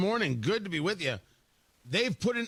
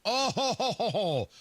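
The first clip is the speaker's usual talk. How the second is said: knowing chuckle. Real, not acted. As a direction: chuckle like you've caught them out. Host gloats over hybrid point.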